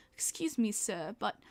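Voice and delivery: puzzled British fan, slowing on the question; British accent. Retold or imitated. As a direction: imitate a polite, baffled British man. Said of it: "so the British guy".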